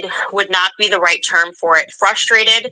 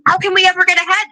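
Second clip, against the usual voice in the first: much higher and fast, exasperated; 11 semitones higher, faster than usual. Real, not acted. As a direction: throw the question up in frustration. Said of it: "rhetorical exasperation".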